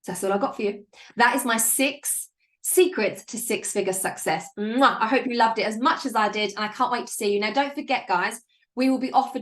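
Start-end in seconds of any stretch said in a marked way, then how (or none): 4.6-5.0 loud smacked "mwah" kiss; sign-off kiss noise; blown kiss. Real, not acted. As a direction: blow the listeners a kiss.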